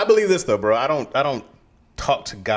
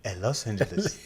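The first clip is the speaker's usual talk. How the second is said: put-on African accent; 4 dB quieter than usual. Kid saying where they're from, mock African accent. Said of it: "with the whole accent".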